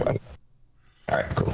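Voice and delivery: soft whispered agreement; whispering. Real, not acted. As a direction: keep it under your breath. Continues the whisper.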